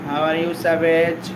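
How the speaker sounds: ordinary talk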